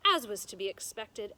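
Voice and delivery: ordinary delivery, nothing marked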